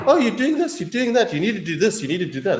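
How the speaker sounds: whiny imitation that starts high and fades toward his own voice; mocking nagger. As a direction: mimic preachy people telling you what to do. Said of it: "we have a whole lot of people saying".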